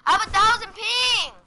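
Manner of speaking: frustrated. Real, not acted. Frustrated gamer.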